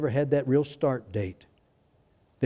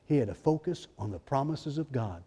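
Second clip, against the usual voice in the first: normal talking voice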